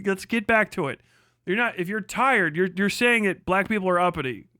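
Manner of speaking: ordinary talk, nothing marked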